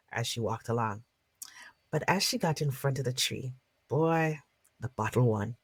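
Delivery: lively storyteller narration; story narration. Acted. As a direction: tell it to a circle of listeners. Storyteller narrates folk tale.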